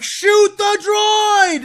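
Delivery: high, slow, drawn-out shout at the TV; frustrated fan screaming at the screen; yelling at the screen. Retold or imitated. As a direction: bellow the order at the droid like it can hear you. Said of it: "I'm like".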